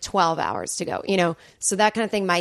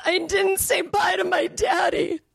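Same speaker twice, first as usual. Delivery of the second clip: high, slow, tearful cry imitating dad; imitating grieving dad; 11 semitones higher than usual. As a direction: wail it slowly like a bereft son. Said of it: "He trips up the steps in grief".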